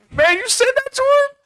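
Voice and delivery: squeaky impressed voice for the cop; high, loud mock cop voice; high amused cop voice. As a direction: pitch way up as the delighted cop.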